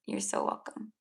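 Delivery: low, hushed whisper; a barely voiced echo of thanks. Real, not acted. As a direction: breathe the words out softly. Repeating thanks softly again.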